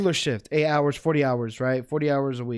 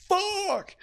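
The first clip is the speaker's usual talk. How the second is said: high groaning curse. Retold or imitated. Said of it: "I'm like".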